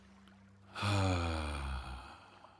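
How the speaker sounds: long audible sigh out; voiced sigh. Shown as a demonstration. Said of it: "feel your voice in your body as you sigh".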